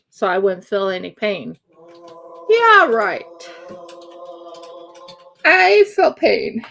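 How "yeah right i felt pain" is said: loud, sarcastic and indignant. Real, not acted. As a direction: scoff at the promise, then insist. Sarcastic about painkillers not working.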